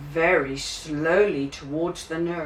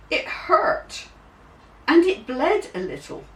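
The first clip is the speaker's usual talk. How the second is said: pained; 10 semitones higher, 3 dB louder than usual. A narrator's reading. Child's finger hurts in story.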